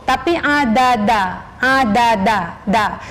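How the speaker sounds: slow, low "adada" with the tongue on the front teeth; slow correct "adada". Shown as a demonstration. Teacher models the correct "adada" for students. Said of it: "letakkan lidahnya pas".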